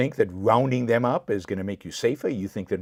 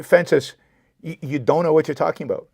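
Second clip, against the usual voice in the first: higher and louder, cutting the commenter off; heated rebuke; 6 semitones higher, 6 dB louder than usual. Real, not acted. Rebuking the commenter Francis, heated and raised. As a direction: snap back at him, exasperated.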